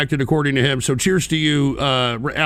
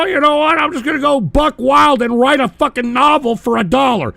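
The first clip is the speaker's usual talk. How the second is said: much higher, louder, hammed-up voice of a cheap donor; mocking donor; 8 semitones higher, 4 dB louder than usual. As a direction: ham up a careless donor going buck wild. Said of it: "does not mean that you".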